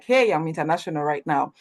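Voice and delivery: ordinary talk, nothing marked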